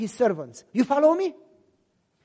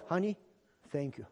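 much softer, low and tender; husband thanking his wife, soft and tender; tender thanks; 10 semitones lower, 15 dB quieter than usual. Retold or imitated. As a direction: thank her gently, almost under the breath.

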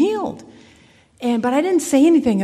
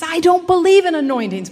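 retold yell; 7 semitones higher, 3 dB louder than usual. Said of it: "blurts out, yells out".

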